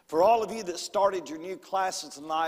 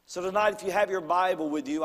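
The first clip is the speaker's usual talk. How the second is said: ordinary talk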